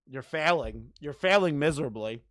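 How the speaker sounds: ordinary talk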